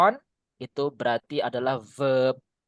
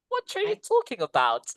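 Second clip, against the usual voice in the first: very high-pitched comic confusion; mock bafflement. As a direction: squeak it like a baffled listener. Acts out listener baffled by fragments. (pura-pura bingung dengan suara melengking)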